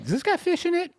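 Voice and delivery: high-pitched quote. Retold or imitated. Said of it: "So you'd be like".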